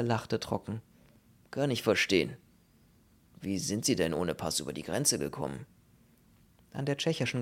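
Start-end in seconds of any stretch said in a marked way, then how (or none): 1.5-5.7 low-voiced older police official; dry senior commissioner. Commissioner questions a refugee about the border, dryly.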